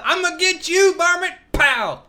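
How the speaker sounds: high, loud shooter's holler; hammed-up varmint hunter. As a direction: yell the threat, then fire with your mouth. Acts out the shooting.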